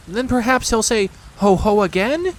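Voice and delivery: high, anxious piglet question; small pig fearing the Heffalump's return. A narrator's reading. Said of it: "suggested Piglet anxiously".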